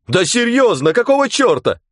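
high, loud alarmed calls; alarmed shouting. Acted. Onlookers on the shore call out in alarm.

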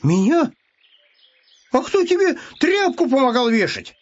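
high, offended protest; indignant hare. Acted. Hare who helped with the rag, high and indignant.

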